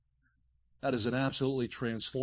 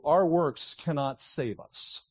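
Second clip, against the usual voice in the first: slow deliberate statement; much slower than usual. Shown as a demonstration. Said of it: "let me just say to you".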